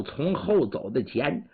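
ordinary delivery, nothing marked

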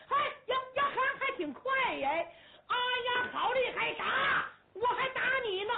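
high, loud thrilled shouts; delighted by a worthy opponent; excited young warrior mid-fight. Acted. 交战中朱木英叫喊. (打得兴起的先锋官，高声叫喊)

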